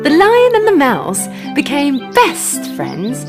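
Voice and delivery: plain reading voice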